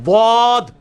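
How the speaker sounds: "ضاد" loud, tongue side to teeth; letter dad. Shown as a demonstration. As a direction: sound a heavy "ضاد". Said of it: "اس کو اوپر کے دانتوں کی جڑ میں لگانا ہے،".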